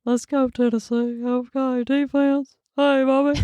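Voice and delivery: Bobby from King of the Hill voice. Retold or imitated. Friend mimics Bobby cheering on Tennessee, in a high cartoon voice. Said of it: "I love that voice so much".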